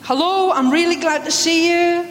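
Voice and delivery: genuinely glad welcome; warm hello. Shown as a demonstration. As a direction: greet them like you really mean it. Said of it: "wish one another God's peace".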